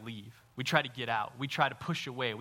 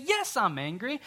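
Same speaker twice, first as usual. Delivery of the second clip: much higher, strained mock outrage; mock angry Jonah; 13 semitones higher than usual. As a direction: squeal it like a sulking prophet. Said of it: "He has the audacity to say".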